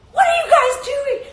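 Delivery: high, loud exasperated yell; imitated yelling. Host imitating the goalkeeper yelling at celebrating teammates. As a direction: yell at your teammates in disbelief. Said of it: "She's yelling at her teammates".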